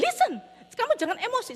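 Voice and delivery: high, loud demand for attention; retold parental scolding, pitched high; exasperated command. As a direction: cut sharply across a child's fuss. Parent cuts off upset child.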